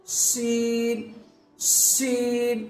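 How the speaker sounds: شین with a long, slow hushing sh like shushing someone; drawn-out sheen. Shown as a demonstration. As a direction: stretch the sh of شین slowly. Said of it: "سیٹی بجاتے ہیں نا".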